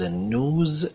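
"نوز" as NOOZ, drawn out, no y-glide; American pronunciation. Shown as a demonstration. Teacher contrasts the American form, "نوز" said as nooz. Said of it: "تلفظ امریکن هست".

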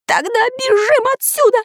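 panicked high yell; terrified shout. Acted. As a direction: scream it in terror. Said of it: "крикнул перепуганный до смерти".

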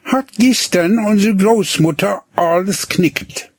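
lightly voiced boy, dry and matter-of-fact; a plain country boy explaining; boy Hinnerk. A narrator's reading. Boy explains to the girl, dry and matter-of-fact. Boy's voice in dialogue.

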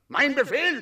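outraged roar. Acted. Dragon shouting at the girl who defied him. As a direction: bellow it as the enraged dragon.

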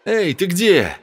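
calling out. A narrator's reading. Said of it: "громкий голос Сэма".

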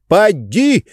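rising shout; shouting match. A narrator's reading. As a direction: fling it back up. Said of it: "закричали друг на друга".

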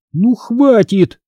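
high, stretched exasperated order; cutting off firmly. A narrator's reading. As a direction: put a firm stop to it. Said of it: "отрезала я".

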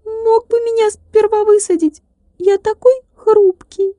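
high thin ringing voice, complaining; a fragile little man sulking; reproachful tiny glass man. A narrator's reading. Tilka complaining. (обиженный стеклянный человечек, тонко и звонко)

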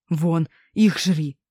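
slow, hoarse, pushed-out words; hoarse mutter. A narrator's reading. Frightened man pointing the wolf at the girl, slow hoarse mutter. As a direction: rasp it out slowly, scared. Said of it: "сипло пробормотал мужик".

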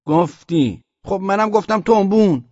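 high, loud protest; loud arguing back. A narrator's reading. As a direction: protest your innocence to the commander. Soldier argues back in shouting match. (اعتراض با صدای بلند)

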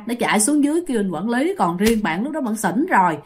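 ordinary talk

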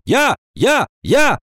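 high, eager repeated cries; clamouring shouts. A narrator's reading. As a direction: compete to be called on. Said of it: "двадцать четыре тоже закричали". (наперебой кричать)

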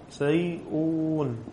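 سيئون said softly and slowly; slow dictation. Shown as a demonstration. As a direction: draw out سيئون quietly. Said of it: "ليش على الياء؟".

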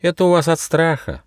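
high, sensible little mouse; know-it-all mouse voice; reasonable. Acted. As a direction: explain it matter-of-factly in a high voice. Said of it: "рассудительно объяснил мышонок".

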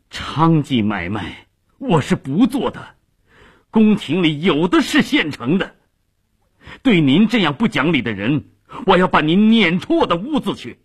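high-pitched indignant outburst; trembling with rage. A narrator's reading. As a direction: throw them out of your house, furious. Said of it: "气得直打哆嗦".

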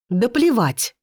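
high angry yell. A narrator's reading. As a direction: scream it back at him. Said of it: "орёт Лита". (злобный крик)

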